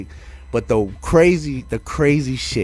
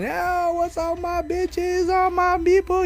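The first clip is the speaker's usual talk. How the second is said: high, slow falsetto diva greeting; imitating a drag host's high welcome; slower than usual. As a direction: sing out to the crowd in a high camp voice. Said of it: "She fucking".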